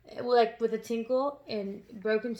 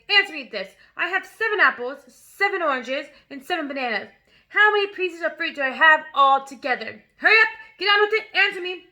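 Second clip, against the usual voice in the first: loud, higher, impatient bark; bullying shout; 4 semitones higher, 7 dB louder than usual. A narrator's reading. Headmistress bullying a pupil, loud and impatient. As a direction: hammer the boy with the question. Trunchbull bullies Wilfred.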